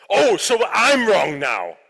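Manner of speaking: loud, slow, offended mock-mom voice; hurt mom retort. Mother offended by her son's advice, slow and hurt. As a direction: throw it back wounded.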